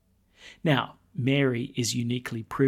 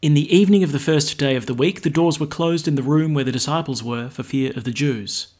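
louder formal gospel reading; gospel proclamation; 5 dB louder than usual. Acted.

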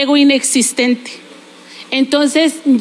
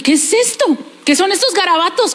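higher-pitched mock bafflement; baffled reaction; 4 semitones higher, faster than usual. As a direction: act out puzzled disbelief, pitched up. Retells bafflement at Greek script. (desconcierto fingido)

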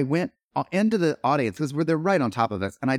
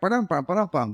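sitcom rimshot; 7 semitones higher, faster than usual. Retold or imitated. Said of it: "like eighties style sitcom comedy".

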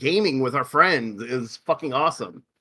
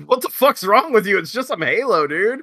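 exasperated scolding; 7 semitones higher than usual. Retold or imitated. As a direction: shout down a friend over a game. Scolding the raging friend.

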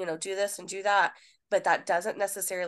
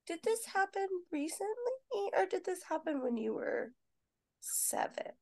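mock puzzled question; 12 semitones higher, 6 dB quieter than usual. Nurse mimicking herself puzzling over vague history, high mock question. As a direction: ask it as a mock bewildered examiner.

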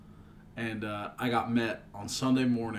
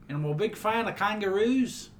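mock Australian; 7 semitones higher than usual. Continues fake Australian bit.

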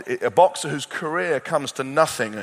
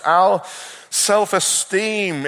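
ordinary talk, nothing marked

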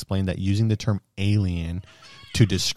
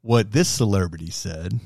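ordinary talk, nothing marked

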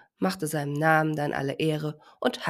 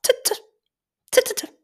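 tiny bird pecking, very high "tit, tit"; high tapping bird sound; 4 dB louder than usual. A narrator's reading. Bird pecking near the drowsy little elephant, very high tapping. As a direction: peck it out in a squeaky high voice. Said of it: "machte es wieder".

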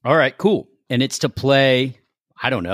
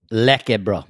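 slangy South African "Lekker, bro"; South African accent; 3 semitones lower than usual. Shown as a demonstration. Trying South African slang with a co-host, South African accent. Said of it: "if you wanna do South Africa".

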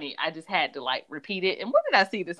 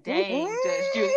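amazed; slower than usual. Retold or imitated. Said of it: "And I was like".

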